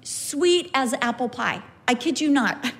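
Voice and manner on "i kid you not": ordinary talk, nothing marked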